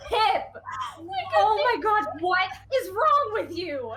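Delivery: high, loud exasperated scolding, drawn out at the end; exasperated telling-off, half laughing; laying into Pip. Acted. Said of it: "Eris is still laying into Pip".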